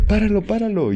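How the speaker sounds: high pleading stop it, stop it; urgent plea. Retold or imitated. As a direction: call out to stop the spinning, high. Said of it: "seguían dando vueltas y".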